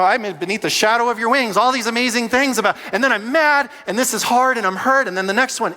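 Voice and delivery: loud, high, fervent preaching; preaching at full force. Acted. Pastor urging the church to bring frustration to God, fervent.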